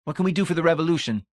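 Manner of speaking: fast, high and eager; fired-up comrade hungry for action; eager demand. A narrator's reading. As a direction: push the question out in a rush. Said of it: "Laws demanded eagerly".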